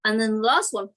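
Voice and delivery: ordinary talk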